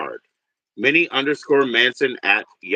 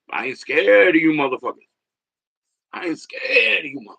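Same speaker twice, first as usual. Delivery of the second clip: imitating Bernie Mac; 6 semitones higher than usual. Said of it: "And he said".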